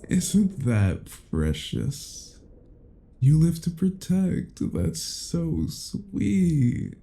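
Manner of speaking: slow, sing-song condescension; cooing at a helpless pet; mock sweetness. Vampire mocks captive's loyalty.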